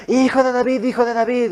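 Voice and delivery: agudo, repetitivo, como pregón; grito hueco imitado. Retold or imitated. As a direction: imita un clamor insistente y mecánico. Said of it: "Ya no está gritando". (grito fingido, agudo y repetido)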